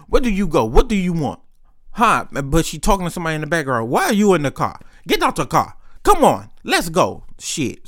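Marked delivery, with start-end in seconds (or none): 0.1-2.3 higher-pitched mock African accent; imitated African accent. Tiffany talking to somebody in the background, imitated African accent. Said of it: "this is her talking in an accent". 3.9-7.2 mock African accent, pitched up and bossy; imitated African accent. Tiffany ordering someone out of the car, imitated African accent. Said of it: "she was doing all that".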